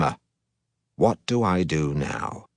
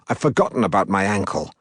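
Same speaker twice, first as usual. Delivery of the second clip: ordinary delivery, nothing marked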